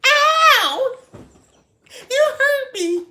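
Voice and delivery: high, loud hammed-up cry of hurt; mock yelp of pain. Client burnt in the chair yelping at the stylist, hammed up. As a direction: ham up a pained client's yelp. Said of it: "all I heard was".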